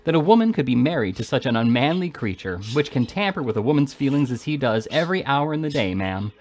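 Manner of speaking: ordinary delivery, nothing marked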